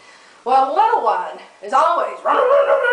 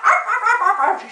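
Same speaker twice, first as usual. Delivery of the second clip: imitated barking; 10 semitones higher than usual. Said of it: "She's growling at me".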